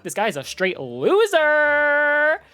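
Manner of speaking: high, loud and slowed right down; mocking schoolgirl. Retelling the girl's verdict, loud slow jeer. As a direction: jeer it out like a bratty girl. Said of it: "and she's like".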